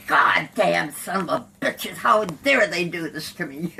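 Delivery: loud, indignant swearing; retold cussing. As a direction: curse them out loudly, reliving it. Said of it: "And I start cussing".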